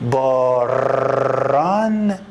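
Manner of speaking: slow teaching read of باران; drawn-out example word. Shown as a demonstration. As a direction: say باران very slowly, stretching the r in the middle.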